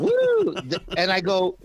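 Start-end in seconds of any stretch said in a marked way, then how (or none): 0.0-0.5 very high, loud whoop of a cheering crowd; mock cheering whoop. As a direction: whoop like the women in the audience. Imitating women cheering in audience.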